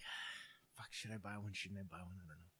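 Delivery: imitated muttering to himself, trailing away; low, soft self-debate, fading out; hushed dithering. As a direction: agonize under your breath, half whispered. Acts out dithering over a purchase.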